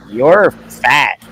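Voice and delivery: put-on blunt little kid. Little sister to strangers at the mall, loud blunt blurt. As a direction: imitate a child announcing it loudly.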